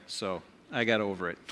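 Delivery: usual speaking voice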